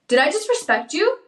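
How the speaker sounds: high, rising, snippy "Did I disrespect you?" with an edge; accusing tone. Shown as a demonstration. Host shows wives the wrong tone to use, snippy and rising. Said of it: "if you say it like".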